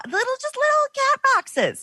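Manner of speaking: high squeaky mock voice; mock squeal. Guest mocking tiny LA houses, squeaky high. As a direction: squeak it out in disbelief.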